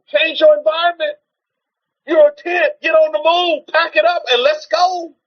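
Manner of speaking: high, driving call to move; urgent exhortation. Acted. Preacher urging the congregation: high, driving call. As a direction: rouse them to pack up and go.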